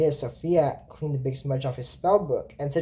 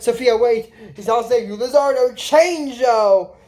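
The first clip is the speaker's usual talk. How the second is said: mock alarmed shout; 6 semitones higher, 5 dB louder, slower than usual. Character yelling in alarm.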